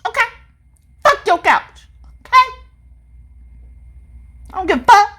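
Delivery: high, loud, brash shout as [name]. Retold or imitated. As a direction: yell it like a cocky funk star who doesn't care. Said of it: "he was like".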